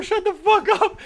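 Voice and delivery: high, loud laughing rage shout; irate yell at everyone, laughing; furious yell. Retold or imitated. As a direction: blast it through laughter. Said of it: "I was so irate in my dream".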